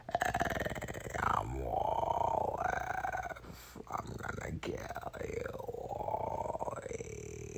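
whispered low robot voice; evil robot voice. Acted. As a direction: hiss threats as a robot. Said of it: "that's enough of that voice".

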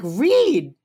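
high, loud cry of his name; mock scolding shout. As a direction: shout the name in outraged disbelief. Acted colleague scolding Reed outside.

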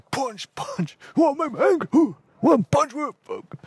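very high ranting between punches; enraged attack. Acted. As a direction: act out a raging man throwing punches. Acted receptionist punching the backpack.

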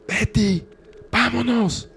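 high, loud panicked yell; panicked shout. Acted. Barney yells at his wife to leave, panicked high shout. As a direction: scream for her to get out now. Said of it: "le grita".

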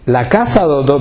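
normal talking voice

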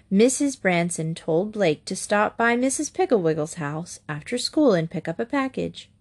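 ordinary delivery, nothing marked

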